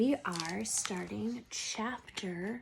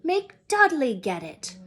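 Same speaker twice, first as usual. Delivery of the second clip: mock protest; 4 semitones higher, 8 dB louder than usual. Harry and Dudley trading chore.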